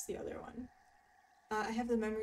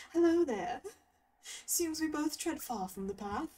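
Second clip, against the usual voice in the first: posh British Jedi voice, pitched up; Obi-Wan Kenobi; 3 semitones higher than usual. Acted. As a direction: read it like a posh, courteous Jedi master. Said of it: "that was my best Obi-Wan Kenobi impression".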